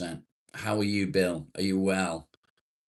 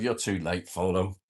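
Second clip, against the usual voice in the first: ordinary talk, nothing marked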